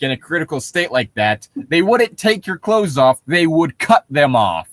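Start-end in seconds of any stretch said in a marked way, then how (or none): none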